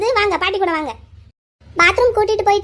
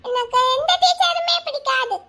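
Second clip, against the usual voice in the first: put-on sulky child; 6 semitones higher than usual. Child telling the teacher off, sulky little voice. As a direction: play a small child refusing to be friendly.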